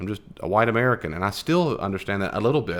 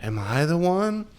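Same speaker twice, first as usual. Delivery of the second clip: mock anguish, pitched much higher and slowed; high, drawn-out mock-anguished plea; anguished question; 9 semitones higher, slower than usual. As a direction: ham up a church-play disciple's despair. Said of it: "they're not sad and anguished and go like".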